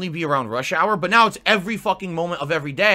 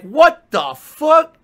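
drawn-out, high, loud "what the fuck"; stunned disbelief; 9 semitones higher, 10 dB louder, much slower than usual. Retold or imitated. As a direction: slowly stretch it out, high, loud and incredulous. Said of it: "I was like".

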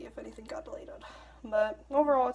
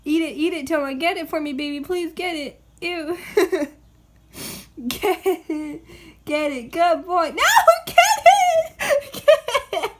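talking to a pet; 10 semitones higher, 12 dB louder, much slower than usual. Real, not acted. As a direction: cheer the cat on, laughing. Coaxing pet, laughing.

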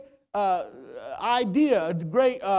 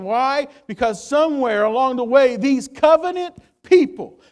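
louder, driving sermon voice; full-force preaching; 7 dB louder than usual. Acted. Preacher warning his church, loud and insistent. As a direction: press the point hard.

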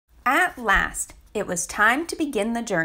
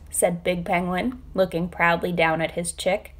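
plain reading voice

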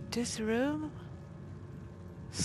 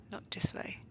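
whispering; 9 dB quieter, faster than usual. Real, not acted. Sneaking quietly in the game.

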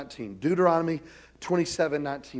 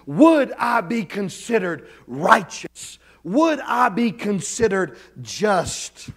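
slow, growing louder and higher each line; building preaching; 4 semitones higher, 5 dB louder than usual. Acted. Pastor pressing the teenagers with rising rhetorical questions. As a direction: build the repetition to a climax. Sermon climax, rising repetition.

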